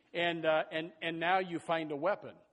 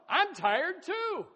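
high, slow, loud whine; mock whine; 12 semitones higher, 9 dB louder, much slower than usual. Another member joins the complaint, high slow whine. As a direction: drag out tired like a moaner. Acted churchgoer joining in.

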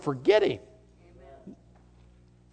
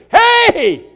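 a loud, high call of Hey; shouted hey; 10 semitones higher, 6 dB louder than usual. Pretend. Preacher voicing miracles screaming in our faces, loud. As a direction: grab their attention. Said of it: "keeps screaming in our faces".

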